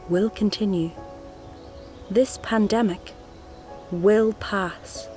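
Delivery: ordinary delivery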